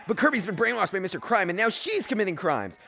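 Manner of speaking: ordinary delivery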